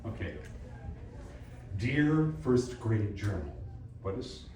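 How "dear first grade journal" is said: slow, carefully articulated "Dear first grade journal"; clearly articulated. Shown as a demonstration. Coach showing student clearer diction on "Dear first grade journal", slow and articulated. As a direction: give "Dear first grade journal" crisp consonants, word by word. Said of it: "articulate just a little bit better".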